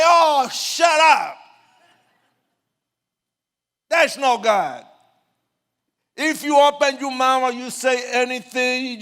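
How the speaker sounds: loud, high-pitched threatening shout; angry heckling. Retold or imitated. Gang member silencing the street preacher, furious shouting. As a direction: shout him down with menace.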